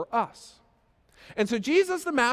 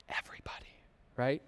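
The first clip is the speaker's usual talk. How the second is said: whisper; 8 semitones lower, 12 dB quieter than usual. Real, not acted. Everybody searching for wallet.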